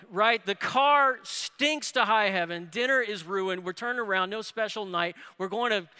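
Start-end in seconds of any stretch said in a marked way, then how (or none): none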